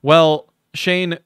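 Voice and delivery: ordinary talk, nothing marked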